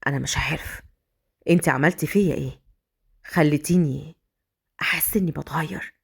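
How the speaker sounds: low, soft, saying it to himself; hushed and tender, talking inside his head; inner confession. Acted. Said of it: "بيقول بينه وبين نفسه". (اعتراف داخلي بصوت منخفض وهادئ)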